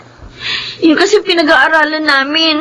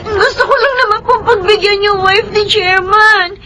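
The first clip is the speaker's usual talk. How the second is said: higher, crying defence; tearful pleading; 8 semitones higher than usual. Acted. Daughter crying to her father to explain herself. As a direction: plead through tears.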